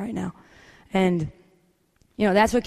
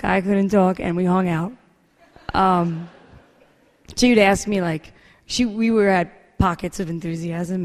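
ordinary talk

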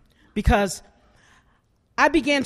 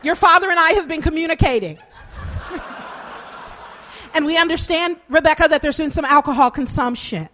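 higher, prim suburban mom voice, quick; 4 semitones higher than usual. Retold or imitated.

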